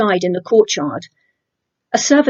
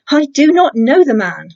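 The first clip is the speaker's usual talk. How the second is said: louder, higher, cursing denial; angry oath; 5 semitones higher, 6 dB louder than usual. A narrator's reading. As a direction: swear it out, raised and heated. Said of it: "Then he began to curse, and he swore an oath".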